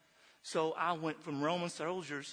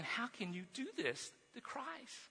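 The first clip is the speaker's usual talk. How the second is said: high, slow, hushed disbelief; mild, pained indignation, retold; retold angry protest; 6 semitones higher, 7 dB quieter than usual. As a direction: draw out the protest, softly pitched up. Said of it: "I'm just so angry".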